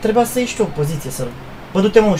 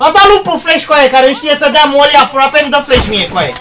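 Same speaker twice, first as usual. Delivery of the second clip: loud, higher angry complaint; angry rant; 4 semitones higher, 7 dB louder than usual. Real, not acted. Gamer ranting at teammate about the flash. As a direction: rant it hard at him.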